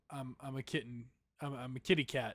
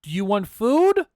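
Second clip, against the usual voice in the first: loud, higher call to a pet; owner calling the cat; 5 semitones higher, 15 dB louder than usual. Pretend. Owner calling pet cat to eat, loud call.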